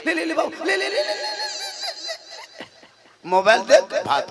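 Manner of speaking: put-on high, quick baby-feeding coo; coaxing mother. Mother feeding rice to her child with a mobile, imitated coaxing. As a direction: imitate coaxing a toddler with repeated little calls.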